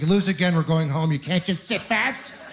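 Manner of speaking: put-on exasperated mum telling off her kid, pitch climbing; mock scolding mother. Mother to her son after musical chairs, mock scolding. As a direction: scold like a fed-up mother, hammed-up.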